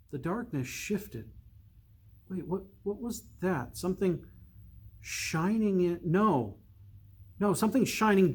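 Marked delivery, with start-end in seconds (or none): none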